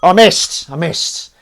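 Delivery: loud, high cry of frustration; dismayed outburst. Real, not acted. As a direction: yell out the near miss. Mock dismay at missing.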